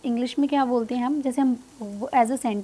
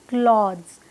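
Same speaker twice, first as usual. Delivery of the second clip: British क्लॉथ्स with a breath after the K; a British-style falling "khlodz"; British pronunciation; 3 semitones lower, 4 dB louder, slower than usual. Shown as a demonstration. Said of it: "जो British pronunciation है".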